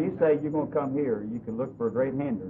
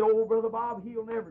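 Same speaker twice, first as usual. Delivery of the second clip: higher, earnest pleading; pleading reassurance; 9 semitones higher, 3 dB louder than usual. Retold or imitated. Pastor begging the weeping preacher, voice pitched up. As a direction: plead with him to believe you.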